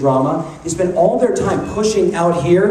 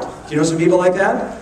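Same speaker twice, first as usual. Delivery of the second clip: ordinary delivery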